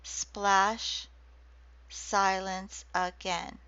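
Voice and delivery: very slow, each syllable set apart; slow syllable-counted reading. Shown as a demonstration. Lecturer showing haiku syllables to class, very slow reading. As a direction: count the syllables out as you say them. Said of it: "a pattern of five, seven, five".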